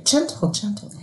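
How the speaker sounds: gentle coaching. Shown as a demonstration. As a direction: coax a toddler to be gentle. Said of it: "You could just say".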